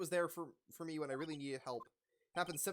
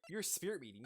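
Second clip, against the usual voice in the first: ordinary delivery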